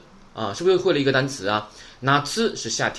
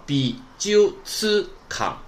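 美術館 very slowly, bi-ju-tsu-kan in separate pieces; slow teaching breakdown of 美術館; syllable by syllable; 5 semitones higher, much slower than usual. Shown as a demonstration. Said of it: "连起来".

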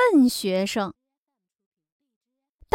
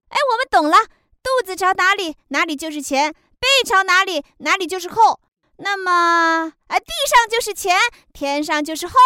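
put-on higher, eager young students' voices; eager students; 7 semitones higher than usual. Chameleon and crab proudly answering the bear teacher, eager and high. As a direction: chirp it back proudly, sure they've got it.

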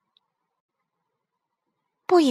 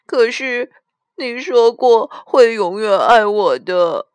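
loud, drawn-out acted wail; tearful child; 5 semitones higher, 6 dB louder than usual. A narrator's reading. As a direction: plead with mum through mock tears, high and slow. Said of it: "阿丽哭着说". (小女儿拖着哭腔哀求)

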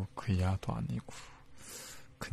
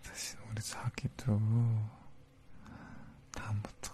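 whispering. Acted. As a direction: gently whisper a warning. Gentle whispered warning.

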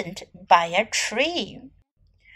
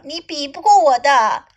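usual speaking voice

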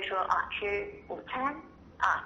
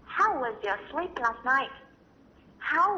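each word drawn out slowly for learners; slow demo; 3 semitones higher, much slower than usual. Shown as a demonstration. Teacher modelling the phrase for parents, slow and careful. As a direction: spell it out slowly so they can follow. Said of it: "美国人常用的说法".

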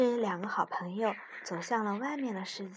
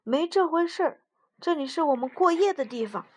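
higher, louder startled reply; surprised; 7 semitones higher, 9 dB louder than usual. A narrator's reading. Mouse is startled frog wants to go home, surprised. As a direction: blurt it out taken aback. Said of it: "老鼠大吃一惊".

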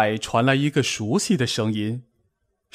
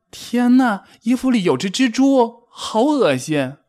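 high-pitched horrified cry; alarmed squeal at a creepy-crawly; frightened; 11 semitones higher, 3 dB louder than usual. A narrator's reading. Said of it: "蹦蹦害怕得直发抖".

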